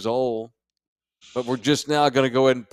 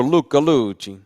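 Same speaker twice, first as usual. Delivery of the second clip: Italian accent; 5 dB louder than usual. Shown as a demonstration. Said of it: "Just decided to say it like that".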